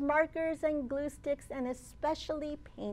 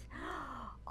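quiet surprised gasp; soft gasp; 13 dB quieter than usual. A narrator's reading. Story twist.

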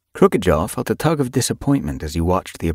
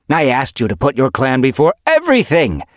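loud, furious she-cat; angry; 7 semitones higher, 6 dB louder than usual. A narrator's reading. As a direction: shout it in rage. Mapleshade furious at Crooked Jaw.

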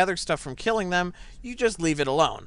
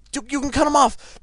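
urgent coaching; 7 semitones higher than usual. Real, not acted. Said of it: "my yelling and stuff".

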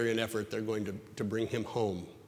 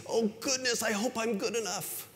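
mock anxious worry; 11 semitones higher than usual. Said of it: "out of this yearning".

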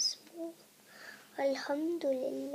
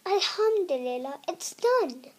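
somewhat louder, glad cry; happy shout; 3 semitones higher, 7 dB louder than usual. Acted. Princess finishing the web with a happy mild shout. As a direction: cheer the finish lightly. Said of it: "shouted happily".

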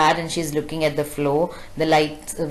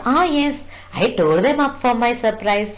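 put-on much higher, bright little girl answering; child's voice; 6 semitones higher than usual. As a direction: sound like an eager small girl. Kezia answers her mother.

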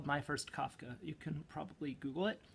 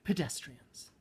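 half slam poem, half Torah; 3 semitones higher, 5 dB louder than usual. Pretend. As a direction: slam poetry crossed with Torah recitation. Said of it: "This is also, like, half slam poem and half, like, Torah".

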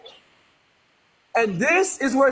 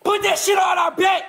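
hyped shout; 8 semitones higher than usual. Real, not acted. Co-host echoing the streamer's rallying call, shouting. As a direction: chant it back with laughter.

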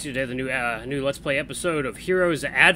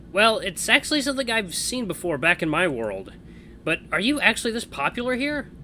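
higher, wondering hero voice; curious young hero; 8 semitones higher, 3 dB louder than usual. Acted. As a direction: ask it with rising curiosity.

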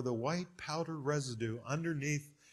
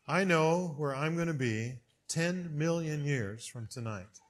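normal talking voice

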